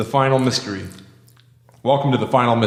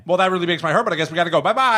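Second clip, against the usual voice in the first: really fast; 7 semitones higher, faster than usual. Shown as a demonstration. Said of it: "let's talk really fast".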